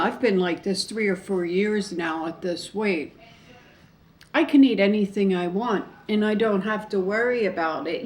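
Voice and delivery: ordinary talk, nothing marked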